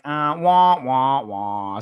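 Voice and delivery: mocking "wah, wah, wah" noise; sad trombone imitation. Mocking corny scene with sad trombone.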